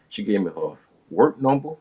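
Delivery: ordinary talk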